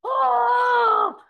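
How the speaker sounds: loud, high drawn-out "oh!"; imitated group reaction. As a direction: wail a long shocked crowd "oh". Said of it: "Everyone's like".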